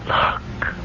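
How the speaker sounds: hushed whispered look. Acted. Ghost daughter whispering to her parents at the window. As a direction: breathe the word in wonder. Ginny showing the blossoming tree.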